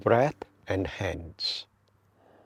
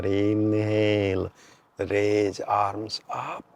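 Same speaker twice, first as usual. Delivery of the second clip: slow, measured meditation guide with long pauses; guided meditation; 5 dB quieter, slower than usual. Real, not acted. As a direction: draw out inhale and exhale cues to pace the breath. Leads a breathing meditation.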